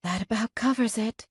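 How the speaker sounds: whispered. Acted. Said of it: "I whisper".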